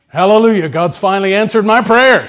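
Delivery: loud, high, laughing cry of joy; joyful parrot. Acted. The female parrot cries out her joy at the kiss, loud and high. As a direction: shout it out like a bird finally answered. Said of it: "The female parrot says".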